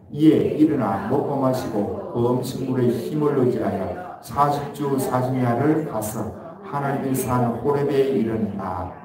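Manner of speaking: steady, measured Bible reading to the church; scripture reading. Acted. Pastor reading scripture to the congregation, measured. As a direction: read the verse aloud, even and formal. Reads verse after "시작.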